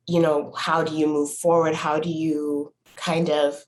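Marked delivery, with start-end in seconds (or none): none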